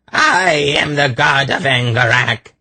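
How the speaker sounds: high, frenzied proclamation; mad shriek. A narrator's reading. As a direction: shriek it like an affronted madman. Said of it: "he shrieked".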